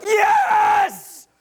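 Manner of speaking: high, drawn-out, gung-ho "yes" shout; over-the-top eager shout; zealous yell. Acted. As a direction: yell it like the keenest recruit. Zealous guy eager for visitation.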